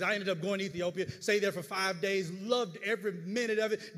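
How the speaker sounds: usual speaking voice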